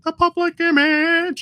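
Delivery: high, drawn-out punk yelp; imitated yelp. Host imitating the song's bridge for a co-host, high yelping. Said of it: "instead of yelping".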